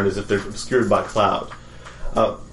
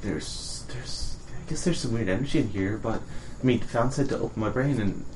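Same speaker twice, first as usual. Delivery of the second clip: soft airy hush; stage whisper; 8 dB quieter than usual. Acted. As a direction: keep it hushed and secretive. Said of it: "stage whisper then".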